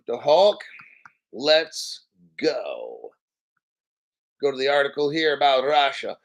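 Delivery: normal talking voice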